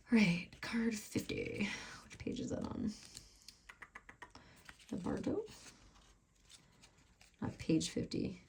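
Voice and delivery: hushed, breathy whisper while searching pages; muttered whisper, looking something up; whispering to herself. Real, not acted. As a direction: whisper it under your breath, just for you. Reading booklet to herself.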